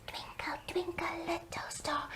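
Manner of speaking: put-on tiny whispered baby voice; soft whispered little one. Shown as a demonstration. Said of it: "like Baby would".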